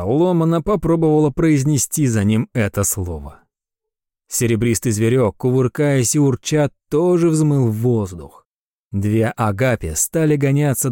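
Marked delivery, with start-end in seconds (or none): none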